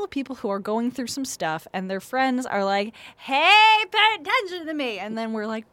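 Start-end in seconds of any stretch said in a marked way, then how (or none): 3.2-5.0 high, loud attention-seeking call; hammed-up needy holler; demanding friend. Friends pestering someone going through stuff, demanding.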